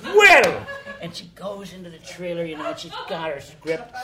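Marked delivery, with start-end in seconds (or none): 0.0-0.7 high, loud mock outrage; indignant outburst. Maureen hears where her hair person is, mock indignant yell. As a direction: explode on the one word, hammed-up.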